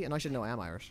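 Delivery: usual speaking voice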